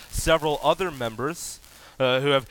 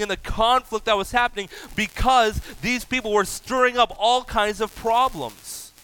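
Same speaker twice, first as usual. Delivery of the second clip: higher, driving preacher voice, pushing ahead fast; fervent preaching; 8 semitones higher, 3 dB louder than usual. Acted. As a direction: preach it with fire to the congregation. Preacher raising intensity.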